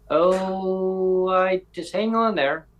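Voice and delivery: drawn-out high hesitation; imitated hedging. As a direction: mimic someone stalling, slow and high. Manager hedging about the unit.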